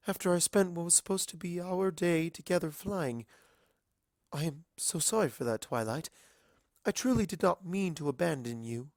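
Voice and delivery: a princess saying sorry with feeling; apologetic princess. A narrator's reading. Celestia apologizes to Twilight.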